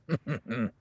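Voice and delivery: a short amused chuckle; little laugh at your own joke. Real, not acted. Rancher teasing the newcomer about the woods, chuckling.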